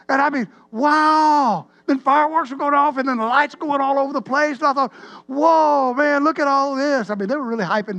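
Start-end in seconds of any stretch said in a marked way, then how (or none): 0.7-1.7 loud, high, drawn-out wow; big awestruck exclamation; amazed wow. Retold or imitated. Acting out amazement at fireworks. 5.3-7.1 loud, high amazed whoa; big wide-open amazement; awestruck excitement. Retold or imitated. Acting out amazement at the show.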